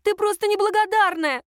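high-pitched, indignant tirade; agitated, aggrieved accusations in a high voice; flaring outburst. A narrator's reading. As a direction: pour out years of envy at sister. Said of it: "вдруг взвилась Надя".

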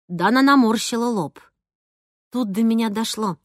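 usual speaking voice

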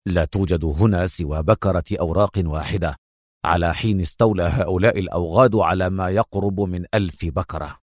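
low, contained anger; restrained anger, pitched well down; angry Adham. A narrator's reading. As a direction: report bitterly in a deep voice. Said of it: "أجابها في غضب".

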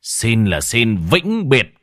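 drawn-out loud farewell command. Acted. Exorcist shouting the final spell at the ghost. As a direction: bark it slow and loud. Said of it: "Cuối cùng cậu quát to".

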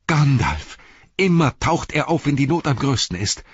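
high, elated cry; shouting. A narrator's reading. Pippin hailing the rescuer in need, high excited shout. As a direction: call it out high with sudden hope. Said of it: "schrie Pippin".

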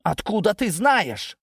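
high, drawn-out alarmed question; alarmed cry. A narrator's reading. Ginzburg questioning Natasha about the secret, alarmed cry. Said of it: "вскрикнул Гинзбург".

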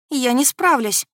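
plain reading voice

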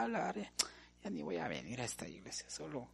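very soft, almost whispered mock sulk; sulky muttering. Preacher mimics a member nobody greeted, sulky mutter. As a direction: grumble under your breath like an offended member.

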